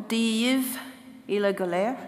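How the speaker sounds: "Div et la galère" said as Irish words; Irish greeting. Shown as a demonstration. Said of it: "comme nous disons en irlandais".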